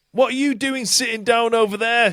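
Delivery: mock [name] shout. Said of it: "she shouted".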